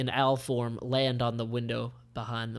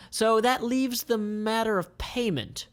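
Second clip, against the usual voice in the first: high, slow businesslike line; higher, slow commander; Melek's voice; 9 semitones higher than usual. Acted. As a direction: bring up money. Melek character voice.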